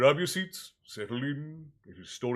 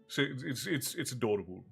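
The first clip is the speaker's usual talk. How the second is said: ordinary delivery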